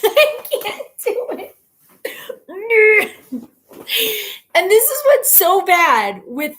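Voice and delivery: high giggling through the words; laughing. Real, not acted. Laughing at herself while admitting she can't do it. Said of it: "laughing at own mispronunciation".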